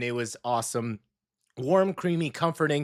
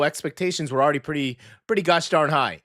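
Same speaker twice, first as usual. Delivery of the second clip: ordinary talk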